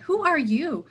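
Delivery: wandering teacher turning the hermit's question around; playful question. A narrator's reading. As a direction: toss the question back with relish. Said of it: "answered Patrul".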